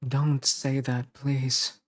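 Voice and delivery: earnest, slightly pleading reassurance; acted devoted partner, measured delivery. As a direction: plead gently with a doubting loved one. Retake of comforting lines.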